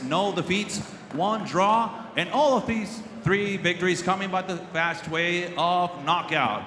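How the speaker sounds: ordinary delivery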